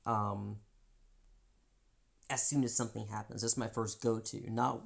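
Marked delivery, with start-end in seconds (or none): none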